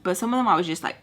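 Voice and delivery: ordinary talk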